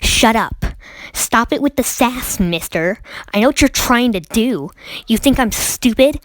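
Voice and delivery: indignant retold telling-off; Karen teacher. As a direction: imitate a teacher telling off a cheeky kid. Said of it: "that was a Karen".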